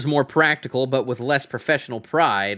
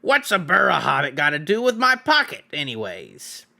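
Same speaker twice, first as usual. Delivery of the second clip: suspicious troll; 3 semitones higher than usual. Acted. Troll William demands answers about his pocket, suspicious. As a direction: grumble the question like a suspicious troll.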